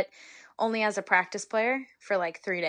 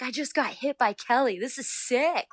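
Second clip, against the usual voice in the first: higher, thrilled inner voice; starstruck; 6 semitones higher than usual. Retold or imitated. Player hit by her idol, thrilled and higher. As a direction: gush like a starstruck fan. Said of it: "I'm like".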